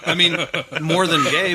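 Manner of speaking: laughing while talking. Real, not acted. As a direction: chuckle through the words. Laughing through awkward admission.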